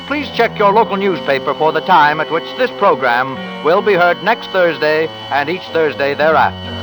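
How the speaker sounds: ordinary delivery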